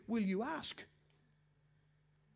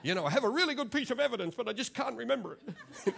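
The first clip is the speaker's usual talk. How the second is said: mock quote; 6 semitones higher, 6 dB louder, faster than usual. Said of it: "she'd never make it in a court of law".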